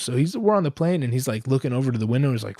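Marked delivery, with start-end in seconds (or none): none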